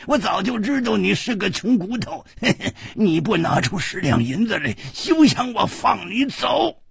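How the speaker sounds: put-on high old man's jeer with cackles; sneering old man, laughing derisively; scornful old vendor.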